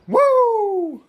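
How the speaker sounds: long, high, loud "woo"; high celebratory holler; excited whoop. Real, not acted. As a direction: let out a big drawn-out whoop. Celebrates the finished tent move.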